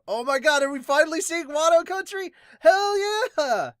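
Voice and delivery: high, loud gleeful cheer; real excitement. Real, not acted. Gamer thrilled to finally see Wano, cheering loud and high. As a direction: burst out with real joy.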